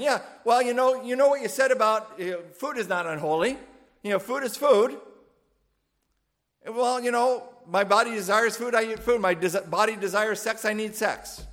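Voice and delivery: high, loud, mocking voice of a self-justifier; mock Corinthian excuse. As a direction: play the smug Corinthian making excuses. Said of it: "it's almost like they're saying".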